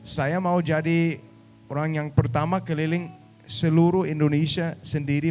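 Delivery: Danish-accented Indonesian; foreigner speaking Indonesian. Real, not acted.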